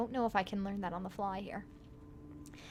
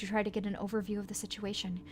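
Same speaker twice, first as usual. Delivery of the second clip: ordinary delivery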